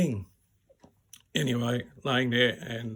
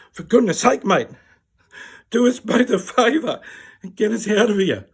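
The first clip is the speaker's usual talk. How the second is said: high, loud, fed-up appeal; exasperated plea; 8 semitones higher, 10 dB louder than usual. Retold or imitated. Bloated patient begging his body to wee, exasperated. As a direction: shout at it to cooperate, thoroughly fed up.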